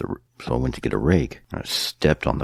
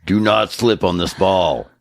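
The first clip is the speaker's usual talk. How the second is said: loud, high public announcer voice; big, high-pitched, hammed-up emergency alert; mock emergency announcement; 9 semitones higher, 6 dB louder than usual. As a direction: call it out to the whole store. Acts out a store emergency announcement.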